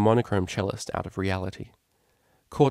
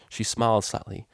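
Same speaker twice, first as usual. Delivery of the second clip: ordinary delivery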